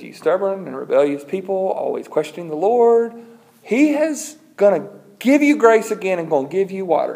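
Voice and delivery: mock-stern public telling-off; acted rebuke. Moses rebukes the people.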